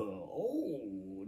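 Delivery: ordinary delivery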